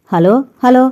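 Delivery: shouting into a dropped phone. A narrator's reading. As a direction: yell down the line for an answer. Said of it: "అవతల నుంచి అరిచాడు manager".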